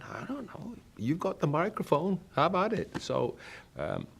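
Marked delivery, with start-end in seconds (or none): 0.0-2.9 team's reply. Retold or imitated. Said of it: "they're all going".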